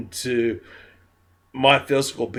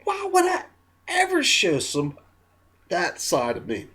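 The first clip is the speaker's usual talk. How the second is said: mock indignant question; 5 semitones higher, 3 dB quieter than usual. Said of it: "would be going".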